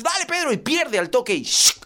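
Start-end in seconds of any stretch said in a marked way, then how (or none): 0.0-0.5 high-pitched urging; frantic dad encouragement; cheering on. Acted. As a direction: egg him on in a strained high voice. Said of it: "Y lo aliento".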